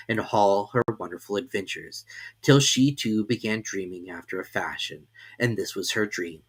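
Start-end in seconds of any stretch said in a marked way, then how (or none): none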